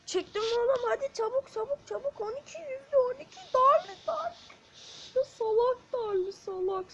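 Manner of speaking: high, loud urgent calls; excited yelling. Real, not acted. Gamer yelling at his teammate to hurry, excited. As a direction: hurry the teammate, pitch rising.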